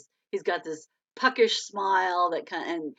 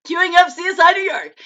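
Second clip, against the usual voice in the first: excited announcement; 11 semitones higher, 12 dB louder than usual. Real, not acted.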